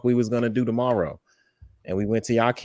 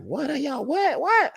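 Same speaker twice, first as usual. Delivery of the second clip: exasperated what, what, pitched up high; incredulous; 14 semitones higher, slower than usual. Real, not acted. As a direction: voice shooting much higher on each repeated what. Baffled at the Abbos' stance.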